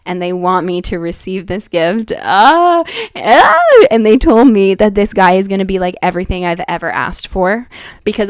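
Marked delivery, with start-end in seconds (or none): 2.2-3.8 two loud rising squeals; excited squeals. Real, not acted. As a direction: let out high, delighted shrieks. Overjoyed at receiving the gift.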